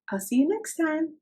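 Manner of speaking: usual speaking voice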